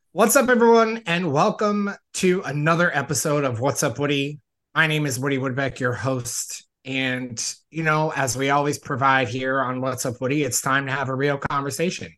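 loud, upbeat show-host delivery; podcast host intro. Acted. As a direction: pump up the welcome like a host. Podcast host opening the episode.

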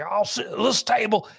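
high-pitched mock Scottish growl; hammed-up Scottish accent. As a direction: imitate a brawling Scot. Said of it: "a Scottish guy came in".

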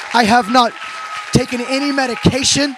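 loud, emphatic retold declaration; triumphant testimony. Climax of retold healing testimony.

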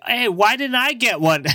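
high-pitched mock whine of his younger self; mock confused self. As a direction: squeak it out, hammed-up confusion. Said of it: "I was like".

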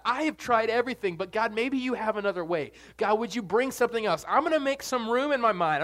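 preaching at full force; fervent preaching. Acted. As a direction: pour it out like a pleading prayer. Praying aloud with rising fervor.

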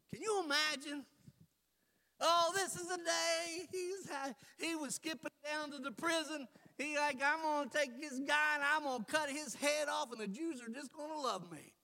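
loud, high, driving sermon voice; preaching at full force. Real, not acted. Preacher rousing the church, loud and high.

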